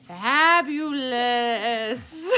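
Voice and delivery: drawn-out, gleeful relish; savouring. Acted. Dog telling children about a wonderful smell, savouring. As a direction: stretch the word out long and slow.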